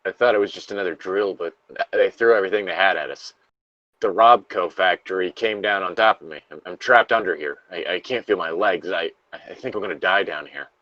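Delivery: a lightly acted trapped survivor, reading steadily; reading a Fallout lore log aloud to a friend, somber. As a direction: read the survivor's diary entry gravely. Reads the log aloud in turn.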